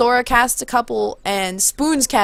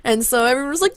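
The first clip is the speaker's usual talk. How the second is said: ordinary delivery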